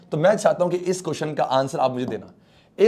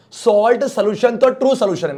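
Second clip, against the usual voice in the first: emphatic correction; 7 semitones higher, 6 dB louder than usual. Real, not acted. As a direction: cut off the wrong answer firmly. Teacher correcting a wrong answer. (ज़ोर देकर टोकते हुए)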